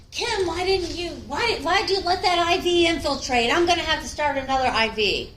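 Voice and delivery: angry coworker yelling over a failed IV; mock angry yell. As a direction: snap at the nurse like a stressed doctor. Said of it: "and he's yelling at me".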